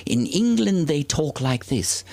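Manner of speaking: high, prissy mock English accent; mimicking a snooty kid's English accent. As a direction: ham up a posh English lilt. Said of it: "they talk like this in London".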